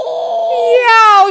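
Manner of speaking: loud, high yowl; pained yell. A narrator's reading. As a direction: yowl like a giant who broke his teeth. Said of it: "shouted Cuchulainn".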